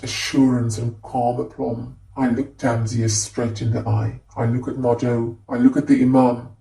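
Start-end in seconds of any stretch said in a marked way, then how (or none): none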